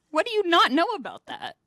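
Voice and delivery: high-pitched imitation of incredulous passengers; mock exasperated passengers. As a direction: throw it back at the driver in mock disbelief. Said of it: "they're like".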